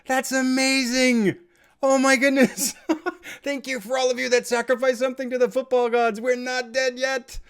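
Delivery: real high, loud disbelieving joy; can't believe the late winner, shout it; excited shouting. Real, not acted. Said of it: "Sorry if I'm getting excited".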